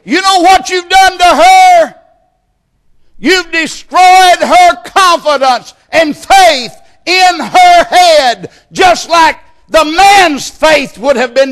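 very high, loud, fiery shout; preaching at full force. Acted. Preacher rebuking husbands in the congregation, shouting hard.

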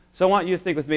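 ordinary delivery

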